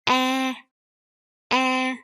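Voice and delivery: EH opened toward "aah"; mid-open mouth. Shown as a demonstration. Teacher compares the first letter, EH with mid-open mouth. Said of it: "Your mouth kind of, like, mid open".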